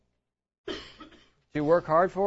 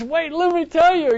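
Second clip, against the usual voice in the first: much higher, louder burst; excited interruption; 11 semitones higher, 11 dB louder than usual. Retold or imitated. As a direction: cut in eagerly, pitched way up and loud. Retold eager interruption of stranger.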